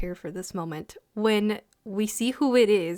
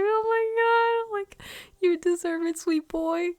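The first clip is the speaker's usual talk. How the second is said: high, slow, gushing coo; melting, high-pitched adoring coo; 10 semitones higher than usual. Retold or imitated. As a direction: coo it like to a sweet kid. Gushing at the sweet character.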